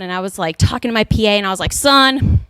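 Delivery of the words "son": drawled Texas scolding; hammed-up Southern drawl; southern accent. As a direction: scold like a stern Southern coach. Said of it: "in my southern accent".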